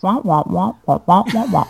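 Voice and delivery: imitating the Peanuts teacher; muffled trombone-like wah wah; muffled wah wah talk. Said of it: "sounds like the teacher from Peanuts".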